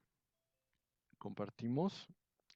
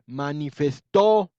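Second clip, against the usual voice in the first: "Manifestó" said slowly, loud on the last syllable; stress on the final O; 3 semitones higher, 13 dB louder, much slower than usual. Shown as a demonstration. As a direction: stretch "Manifestó" and punch the final -tó. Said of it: "En la O". ("Manifestó" lento con golpe en la O final)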